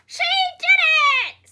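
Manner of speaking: very high, loud screech. A narrator's reading. Hen screeching with joy at the cow's jump. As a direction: screech it in delight. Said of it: "screeched the hen".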